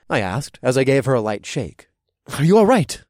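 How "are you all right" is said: urgent. Acted. Checks on hurt Leah.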